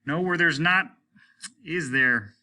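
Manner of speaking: a hint of a bitter captain; rueful captain. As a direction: agree bitterly.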